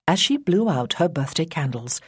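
usual speaking voice

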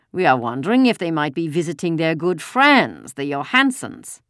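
put-on lightly menacing German-accented officer; German accent, slowing on the last words. A narrator's reading. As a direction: insinuate it in a German accent, drawing out the name. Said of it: "Another German voice said".

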